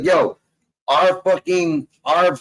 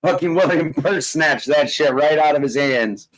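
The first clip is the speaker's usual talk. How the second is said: ordinary talk, nothing marked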